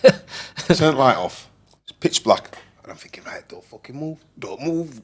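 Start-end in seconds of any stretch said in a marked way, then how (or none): 0.0-1.5 slow, loud, higher mock Scottish; attempted Scottish accent. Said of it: "attempt at a Scottish accent". 3.2-5.0 high, hushed mock panic; tense hush. Frightened thought in the dark.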